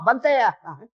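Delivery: ordinary delivery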